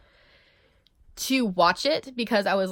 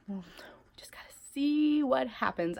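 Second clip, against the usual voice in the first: whisper; 3 semitones higher, 6 dB quieter than usual. Real, not acted. Trailing off about the day.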